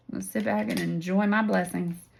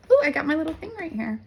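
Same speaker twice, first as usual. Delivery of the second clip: ordinary delivery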